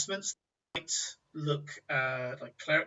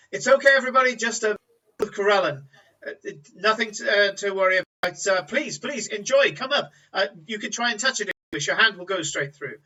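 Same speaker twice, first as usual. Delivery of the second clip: calling out to crowd; 6 semitones higher, 8 dB louder, faster than usual. Acted. Stall keeper calms startled crowd.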